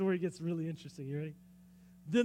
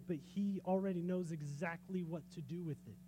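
ordinary delivery

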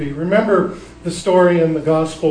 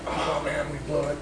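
deflated grumble; 11 dB quieter than usual. Retold or imitated. Other disciples left out.

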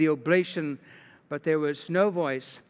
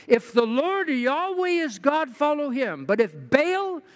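raised, measured preacher voicing Elijah; prophet's challenge; 8 semitones higher than usual. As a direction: put the choice to the crowd emphatically. Elijah challenges the people, read aloud.

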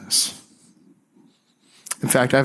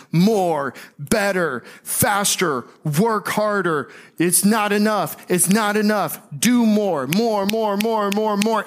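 higher, relentless "more, more, more" chant; demanding voice; 8 semitones higher, slower than usual. Retold or imitated. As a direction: hammer it like a taskmaster who is never satisfied. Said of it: "God was the one who said more".

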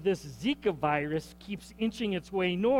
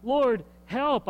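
higher, louder cry for help; desperate plea; 5 semitones higher, 6 dB louder than usual. Retold or imitated. Grandparent crying to the Lord for wandering grandchild, desperate. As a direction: burst out with the plea.

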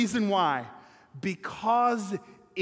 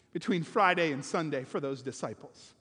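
ordinary talk

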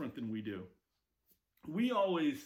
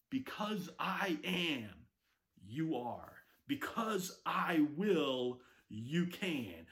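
slow emphatic declaration; much slower than usual. Acted. God's words spoken slowly to Moses.

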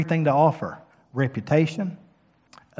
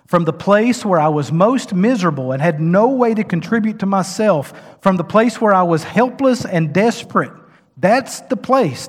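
impassioned preaching, raised and louder; 5 semitones higher, 7 dB louder than usual. Real, not acted. Preacher rising to his congregation, full force. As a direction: drive it home from the pulpit.

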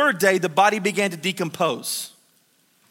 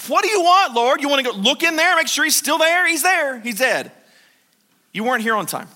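higher, louder, exasperated Martha; exasperated outburst; 7 semitones higher, 5 dB louder than usual. Retold or imitated. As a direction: fling it at him, fed up. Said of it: "Martha has to be exasperated".

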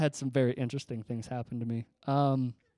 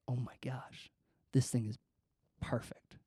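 soft, near-whispered wonder; awed by the perfect fit; whispering to himself in the store; hushed delight; 2 semitones lower, 9 dB quieter than usual. Retold or imitated. Trying on the new helmet.

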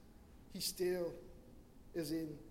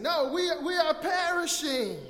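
high, loud, exasperated correction; acted panicked yell to be heard; hammed-up desperate shout through a storm; shouting over wind; 9 semitones higher, 10 dB louder than usual. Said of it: "the wind is so loud they can't hear him".

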